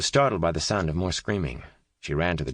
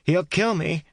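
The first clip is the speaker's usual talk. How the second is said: high, loud shout; 12 semitones higher, 4 dB louder, slower than usual. A narrator's reading. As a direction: shout it back defiantly. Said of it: "shouted Kate".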